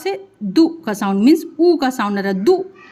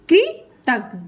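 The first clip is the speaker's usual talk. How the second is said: higher, drawn-out कृ, then तज्ञ apart; slow syllable demo; 4 semitones higher, much slower than usual. Shown as a demonstration. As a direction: break कृतज्ञ slowly into कृ, तज्ञ. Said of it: "उसको slow से बोलेंगे आप". (कृतज्ञ धीरे-धीरे, अक्षर तोड़कर)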